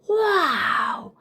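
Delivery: high amazed wow; impressed kid. Acted. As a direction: struck by grandpa's secret. Child impressed.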